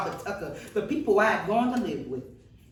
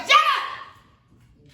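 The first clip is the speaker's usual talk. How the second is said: piercing high shout, much louder; retold yell; 6 dB louder than usual. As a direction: scream it down at her to silence her. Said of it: "they yelled".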